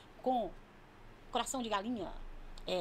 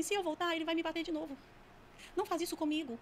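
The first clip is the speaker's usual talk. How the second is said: put-on higher, pleading kid voice; child's voice; 5 semitones higher than usual. Demon telling of a beating, imitated child's pleading voice. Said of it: "E com uma voz de criança".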